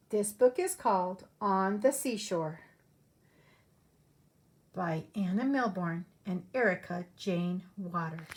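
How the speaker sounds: lively storybook read-aloud voice; animated picture-book narration; reading to children. Acted. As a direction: read it like story time for little kids. Teacher reads picture book aloud.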